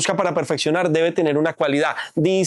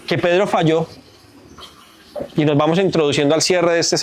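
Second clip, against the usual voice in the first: altar call preaching; 3 semitones lower than usual. Acted. Preacher urging the church to come back, fervent. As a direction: press the congregation to return to God.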